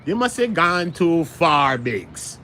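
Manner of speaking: slow Jamaican movie voice; Jamaican accent. Retold or imitated. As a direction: drawl it like a Jamaican movie gangster. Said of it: "Shata's movie, the Jamaican shit".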